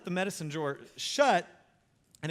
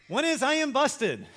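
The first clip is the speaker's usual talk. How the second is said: usual speaking voice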